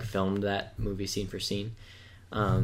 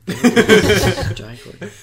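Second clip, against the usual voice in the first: high laughing repeat of the name; 12 semitones higher, 12 dB louder, much slower than usual. Real, not acted. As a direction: say it while laughing hard. Laughing at the suggestion.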